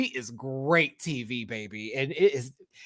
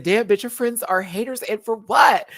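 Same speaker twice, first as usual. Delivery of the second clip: higher, sassier read of Plane's message; Plane's voice, raised and sassy; reading in her voice; 7 semitones higher, 5 dB louder than usual. Retold or imitated. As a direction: put on Plane's voice, punch "what". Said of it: "I had to read it in her voice".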